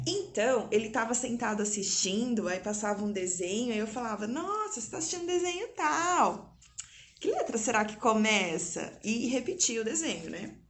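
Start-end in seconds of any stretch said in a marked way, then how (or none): 4.3-9.0 1 mock excited. Mother prompting child, playful.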